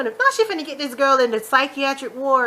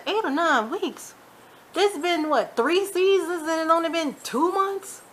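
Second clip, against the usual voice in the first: high, drawn-out disbelief; stretched-out incredulous questioning; 7 semitones higher, slower than usual. Retold or imitated. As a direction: echo it back high and slow, not buying it. Teller's disbelieving echo of the answer.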